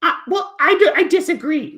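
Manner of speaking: high, loud kitty disagreeing; puppet cat voice. Acted. Cat puppet disagrees with teacher, high and loud. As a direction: protest as the cat.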